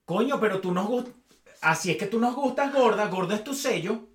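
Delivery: a mocking take on fans calling her fat; mocking quote. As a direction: parody fans who praise her weight. Mocking fans' backhanded praise.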